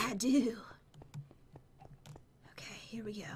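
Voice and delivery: very soft, low whisper; quiet whispering. Real, not acted. Player crafting nervously within earshot, quiet whispering. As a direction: whisper it, barely audible. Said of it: "You're, like, whispering so quietly".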